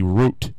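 route said as "root"; route with a long oo; "root" pronunciation. Shown as a demonstration. Host doubting the word could be route, said "root". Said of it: "how that ru-, word could be".

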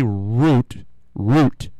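route and root both with a long oo; "root" pronunciation. Shown as a demonstration. Host showing how people say it, route as "root". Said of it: "people say route".

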